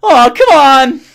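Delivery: loud, high cartoon impression; put-on high-pitched exasperated yelp; Morty. Shown as a demonstration. Demonstrating a cartoon character's accent, loud high impression. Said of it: "the Morty accent is".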